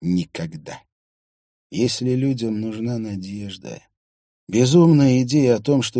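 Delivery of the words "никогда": matter-of-fact, lower-pitched certainty; natural, confident. A narrator's reading. Priestess answering about life after death, natural and confident. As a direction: say it as a settled, self-evident fact. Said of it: "очень естественно и уверенно произнесла это".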